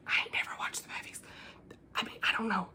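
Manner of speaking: hushed, quick whisper; whispered confession. Real, not acted. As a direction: whisper it fast like an embarrassing aside. Mock-shameful confession aside.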